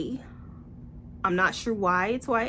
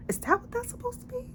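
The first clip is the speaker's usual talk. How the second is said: much higher, fast and soft mock question; squeaky disbelief; 6 dB quieter, faster than usual. Real, not acted. Mocking the book's dialogue.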